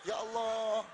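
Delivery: loud pleading call of Ya Allah; crying out to God. Acted. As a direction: cry the last Ya Allah up to heaven. Prayer climax cry. (seruan memohon keras)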